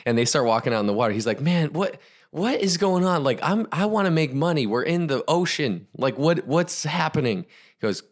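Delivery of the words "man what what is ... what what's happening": high-pitched, exasperated confusion; acting out a confused student; bewildered protest. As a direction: plead to know what this is about.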